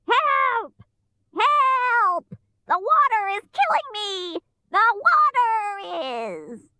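high screeching witch in agony; witch crying out. A narrator's reading. Witch killed by water, screaming cry. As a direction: shriek for help as you die away. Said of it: "she cried out".

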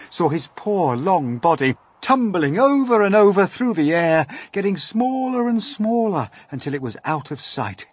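ordinary delivery

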